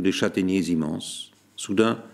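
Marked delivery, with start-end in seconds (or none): none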